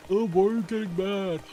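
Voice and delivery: mocking rage baiter. Imitating a rage baiter taunting an angry victim, shrill and mocking. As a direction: needle someone for getting mad.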